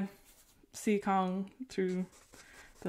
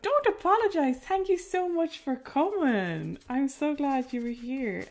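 excited; 8 semitones higher, 7 dB louder than usual. Real, not acted. Thanking a departing viewer.

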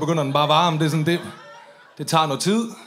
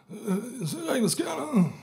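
put-on high, slurred drunk voice, pitched up; mock drunk mumble, much higher; drunk Liam; 7 semitones higher, 5 dB quieter than usual. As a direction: play Liam sloshed and baffled. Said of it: "Sådan, Liam lyder, når han er fuld".

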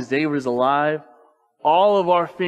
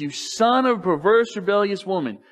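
acted angry insult, higher pitch; Saul's anger; 6 semitones higher, 4 dB louder than usual. Saul turns on his son Jonathan, acted anger. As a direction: hurl the insult like an angry father. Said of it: "He's now angry at Jonathan".

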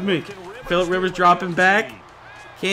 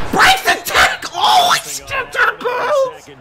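much higher, loud anguished wail; panicked shout; panicked cry; 4 dB louder than usual. Real, not acted. Panicked mistake mid-play.

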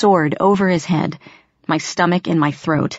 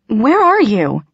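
higher, louder, breathless call into the dark; frightened gasp; 5 semitones higher, 4 dB louder, slower than usual. A narrator's reading. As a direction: gasp it out, scared and searching. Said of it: "I gasped".

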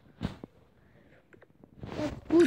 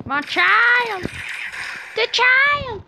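dramatic cry; 8 semitones higher, slower than usual. Real, not acted. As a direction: cry out in mock despair.

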